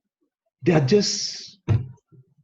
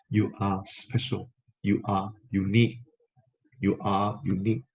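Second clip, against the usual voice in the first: usual speaking voice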